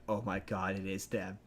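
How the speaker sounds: hushed realization. Retold or imitated.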